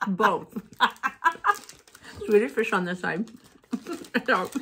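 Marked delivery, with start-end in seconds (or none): 2.3-3.3 eating sour candy. Real, not acted. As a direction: mouth full of candy. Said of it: "It's so sour".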